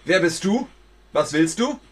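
loud, high demand; challenging shout. Acted. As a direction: call out the stranger. Challenging a creature.

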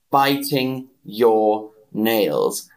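"Biting your nails" said very slow, "Biting" stressed; slow model. Shown as a demonstration. Teacher modelling "Biting your nails" for the chat, slow and clear. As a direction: spell it out word by word, lean on "Biting". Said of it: "teacher models slowly".

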